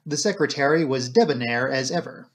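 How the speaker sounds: usual speaking voice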